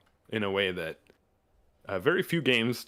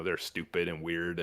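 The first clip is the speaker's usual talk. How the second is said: ordinary talk, nothing marked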